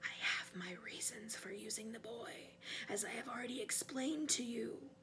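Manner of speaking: whisper, almost a hiss; sinister, hushed villain. A narrator's reading. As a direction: whisper coldly to the servant. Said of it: "in a whisper that was almost a hiss".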